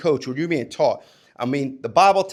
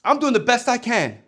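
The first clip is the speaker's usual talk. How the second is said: higher, exasperated self-defence; defensive player; 7 semitones higher, 3 dB louder than usual. Retold or imitated. Athlete pushing back at a coach, mildly exasperated. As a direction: whine that you're already trying. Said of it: "and you're like".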